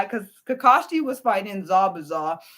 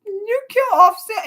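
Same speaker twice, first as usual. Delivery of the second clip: outraged protest; 13 semitones higher, 6 dB louder, slower than usual. Retold or imitated. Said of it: "I was like".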